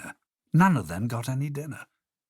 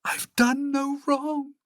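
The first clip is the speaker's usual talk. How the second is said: very high, slow, wailing innocence; pleading cry; slower than usual. Acted. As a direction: beg with a tiny trembling girl's voice. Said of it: "She cried again".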